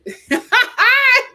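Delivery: high, loud laugh; real high laugh; burst of laughter. Real, not acted. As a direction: crack up. Laughing at comment.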